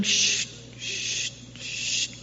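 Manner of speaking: soft unvoiced shh of skates gliding; rollerblading swish. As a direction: hiss the glide of rollerblades.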